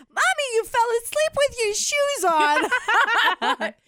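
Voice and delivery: put-on very high, loud toddler voice; three-year-old teasing mommy, high and childlike; little girl voice. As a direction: sing it like a tiny kid. Quoting her three-year-old.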